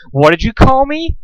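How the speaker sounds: high outraged question; shocked gasp. Acted. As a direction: gasp it in scandal. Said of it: "Rarity gasped".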